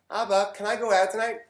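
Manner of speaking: imitating a kid's English voice; kid asking in English. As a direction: ask dad sweetly, like a kid. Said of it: "when my kids, they go from English to Hebrew".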